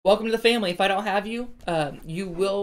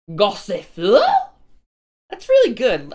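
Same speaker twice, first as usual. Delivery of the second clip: excited; 3 semitones higher, 10 dB louder than usual. Real, not acted. Pulls a card he likes.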